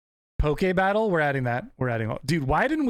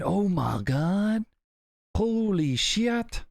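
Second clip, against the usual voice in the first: slow stunned awe; 6 semitones higher, much slower than usual. Real, not acted. Reacting to huge salary.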